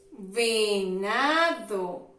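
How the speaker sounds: Venado said very slowly, syllables drawn out; slow model word. Shown as a demonstration. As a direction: stretch Venado out so children hear the syllables. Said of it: "Qué sílaba de la V".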